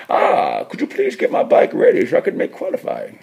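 muffled masked villain, pitch leaping about; put-on movie villain voice behind a helmet; Bane. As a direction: talk as if through a mask. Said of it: "he sounded like Bane from Batman".

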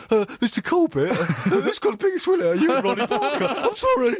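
high, laughing put-on voice; mock bumbling interviewer. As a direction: fumble it like an awkward host. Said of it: "You go".

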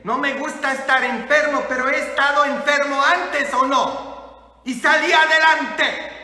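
loud, high, emphatic testimony; forceful preacher's proclamation; fired-up preaching. Real, not acted. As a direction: declare it boldly to the congregation.

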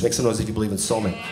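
hurried, dropped-voice repeat. Shown as a demonstration. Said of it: "repeated softer".

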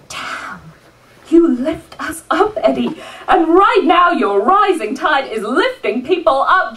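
rousing slam-poetry delivery, swelling and falling; rhythmic live poetry recital; spoken-word poem. Acted. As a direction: perform it as a defiant tribute poem. Performs a spoken-word tribute poem.

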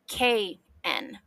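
"K N" as two distinct letters, K then N; K plus N. Shown as a demonstration. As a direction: say K and N as separate letter sounds. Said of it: "this is pronounced like".

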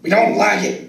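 loud, firm declaration; emphatic. Acted. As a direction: say it flat out and proud. Emphatic Texan answer.

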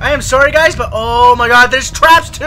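ordinary talk

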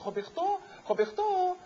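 put-on high, sing-song calling of the boy's name; French madame calling. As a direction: call him through the door as the Frenchwoman, high. Madame knocks at the boy's door. (madame chamando o menino, voz aguda)

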